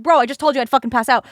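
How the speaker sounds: loud, fast, fed-up retort; exasperated outburst. Retold or imitated. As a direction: snap back at him, loud and fast.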